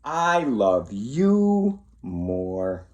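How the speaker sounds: slow, loving goodnight; tender. Acted. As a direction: stretch it out lovingly.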